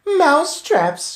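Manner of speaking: high, loud, slow cry. Acted. Witches echoing the word in high, loud delight. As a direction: echo it in delight like a crowd. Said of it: "cried the witches".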